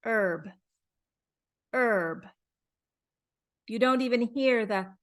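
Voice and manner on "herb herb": Herb said as "erb" with no H sound at all; American Herb, H silent. Shown as a demonstration. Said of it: "It's so quiet".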